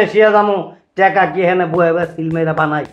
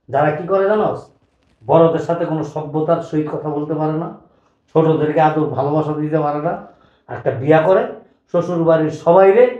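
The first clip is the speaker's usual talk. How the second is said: usual speaking voice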